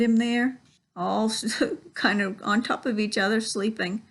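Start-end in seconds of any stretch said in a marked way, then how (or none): none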